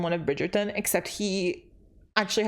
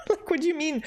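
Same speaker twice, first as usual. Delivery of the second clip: 1 incredulous squeal; 9 semitones higher, faster than usual. Real, not acted. Mocking the silly title.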